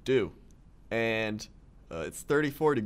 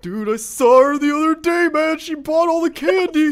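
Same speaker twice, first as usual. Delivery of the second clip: much higher, much louder, wild excited yell; mock excited; 11 dB louder than usual. Co-host playing a frantic witness who spotted the ghost witch, yelling high. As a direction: yell it like breathless gossip.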